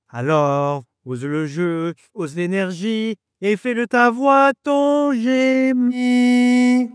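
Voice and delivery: poetic flourish. Acted. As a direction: build to a grand final line. Poetic opening declamation.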